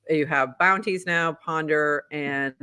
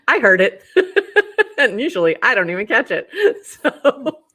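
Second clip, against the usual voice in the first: laughing while talking; amused, breaking into laughter; 6 semitones higher, 7 dB louder than usual. Real, not acted. Laughing at own accent slip.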